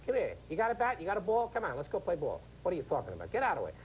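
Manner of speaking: high-pitched New York street kid impression; younger street guys. Younger Harlem guys calling Howie to play, in high street-kid voices. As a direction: pitch it up like kids rounding up a ball game. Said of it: "younger guys talk like that".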